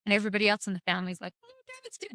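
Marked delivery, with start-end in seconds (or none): 1.4-2.1 very high, tiny soft voice; mock the mourning relatives in a faint high squeak; mimicked family lament.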